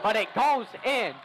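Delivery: excited call. Real, not acted. Live call, three-pointer drops.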